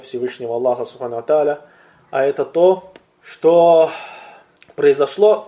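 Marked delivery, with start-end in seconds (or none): none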